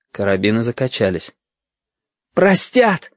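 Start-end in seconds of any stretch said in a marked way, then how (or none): 2.3-3.1 put-on high, defiant boyish cry; bitterly fearless cry. A narrator's reading. Boy answers before the rifles, bitterly fearless. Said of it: "с горьким бесстрашием крикнул".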